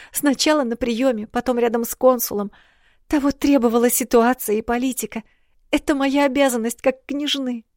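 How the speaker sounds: put-on high, hurried apologetic girl; rapid tearful princess. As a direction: babble excuses in a high voice. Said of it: "затараторила без остановки". (тараторящая княжна высоким голосом)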